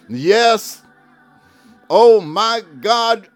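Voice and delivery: normal talking voice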